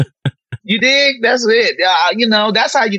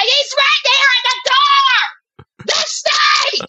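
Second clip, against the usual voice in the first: high, drawn-out scared yell; panicked shriek at a cobra; retold panicked scream; much slower than usual. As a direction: scream it like the snake is at the door. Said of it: "I was like".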